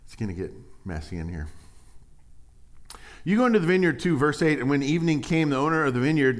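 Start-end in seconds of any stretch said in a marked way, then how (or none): 3.2-6.4 raised, louder preaching read of the verse; scripture reading. Acted. Preacher reading scripture to the church, raised and loud. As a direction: proclaim the verse to the congregation.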